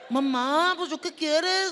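lightly voiced whiny teenager; sulky teen. Teenager brushed off by mom's request, lightly voiced whine. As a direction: grumble back like a put-out teen.

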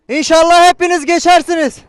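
loud high call to crowd. Real, not acted. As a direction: shout it to passers-by. Calling out to pedestrians.

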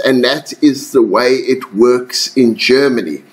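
usual speaking voice

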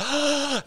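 high sharp mock gasp; high hammed-up gasp; shocked gasp. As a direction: gasp in fake offence. Mock shock at being called old.

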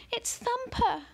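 put-on very high shocked girl's cry; girl's alarmed high cry. A narrator's reading. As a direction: shriek it like a horrified girl. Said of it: "cried Ellie".

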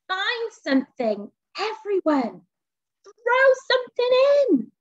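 high witch call, rising to loud; grinning call. A narrator's reading. Witch rallying friends round the cauldron, calling gleefully. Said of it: "said with a grin".

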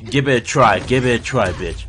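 ordinary delivery

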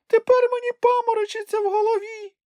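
a giddy little creature moaning; dizzy squeak. A narrator's reading. Dizzy Sniff complains. (писклява скарга)

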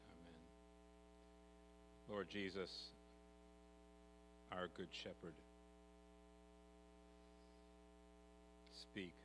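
soft, hushed prayer voice; gentle opening prayer to the congregation. Acted. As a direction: pray quietly and reverently. Opening prayer before sermon.

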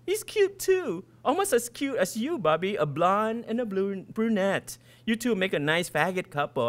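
put-on high, teasing girl's voice; mocking schoolgirl. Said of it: "God delivers stupid Becky".

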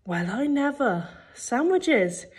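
high, mocking dopey voice; dumb voice. A narrator's reading. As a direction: put on a dim-witted voice to jeer. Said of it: "said in a dumb voice".

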